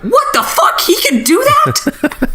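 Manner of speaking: very high, slowed, with a laugh; laughing disbelief. Retold or imitated. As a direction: stretch it out, giggling in disbelief. Hosts' own reaction, replayed.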